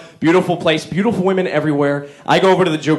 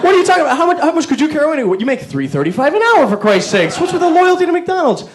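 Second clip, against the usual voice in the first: mock indignant rant; 11 semitones higher than usual. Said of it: "ranting at clerk".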